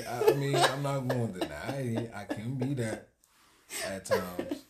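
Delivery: loud, laughing protest; laughing admission. Real, not acted. Admitting to partner a fault just called out, laughing.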